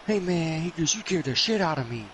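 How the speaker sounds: high, agitated retold protest; worked-up mock scolding; startled complaint. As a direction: imitate a man still jumpy from a scare. Startled man scolds the intruder.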